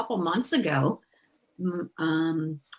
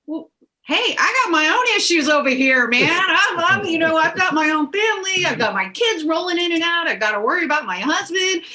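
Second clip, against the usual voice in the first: much higher and louder, a frazzled rant; exasperated outburst; 9 semitones higher, 7 dB louder than usual. Retold or imitated. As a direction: blurt it out like you're overwhelmed and fed up. Voicing her own exasperated reply.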